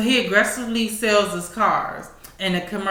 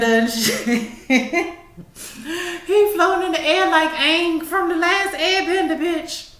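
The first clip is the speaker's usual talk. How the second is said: laughing; 8 semitones higher, slower than usual. Real, not acted.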